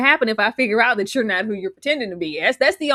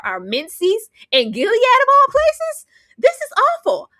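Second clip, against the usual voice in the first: dramatic wail; 12 semitones higher, 6 dB louder than usual. Acted. Daisy's dramatic lament.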